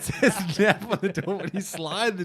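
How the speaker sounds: laughing. Real, not acted. Laughing through the joke.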